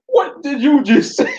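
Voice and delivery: high, slow offended demand; imagined Tom Hanks confronting. Pretend. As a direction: play the outraged father, drawn out. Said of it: "man, I'd be like".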